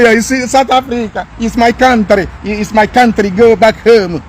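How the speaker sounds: mocking impression of a South African local; mimicked South African. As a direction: put on a local's voice to mock them. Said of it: "You will see South Africa say".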